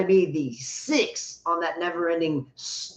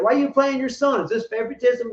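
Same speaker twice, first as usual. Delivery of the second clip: mock reporter; 6 semitones higher, faster than usual. Mocking reporter asks Deion.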